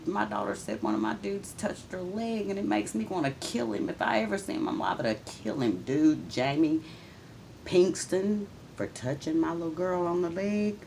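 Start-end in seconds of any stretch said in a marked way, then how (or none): 5.8-10.8 louder and higher, naming the man in rage; furious. Real, not acted. Mother threatening the man who touched her girl, furious. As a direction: call him out by name.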